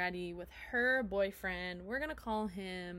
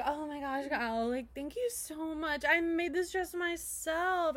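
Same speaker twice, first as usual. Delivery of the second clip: gushing Amber; 6 semitones higher than usual. Retold or imitated. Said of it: "she's like".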